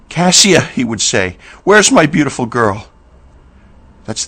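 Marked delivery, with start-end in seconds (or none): 0.1-0.6 loud, higher call of a name. A narrator's reading. Drunk father calling for his daughter, loud. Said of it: "I heard him call out my name". 1.6-2.9 loud, higher sing-song call. A narrator's reading. Drunk father calling through the house for his daughter. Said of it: "I heard him call out my name".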